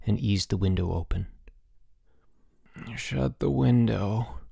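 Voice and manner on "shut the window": slow, drowsy mumble half asleep; sleepy groan. Acted. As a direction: roommate groaning from his sleep, dozy complaint. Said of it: "groaned George in his sleep".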